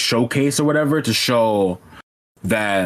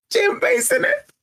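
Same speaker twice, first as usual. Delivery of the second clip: overexcited squeal. Real, not acted. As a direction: voice cracking way up. Hyped, voice cracking high.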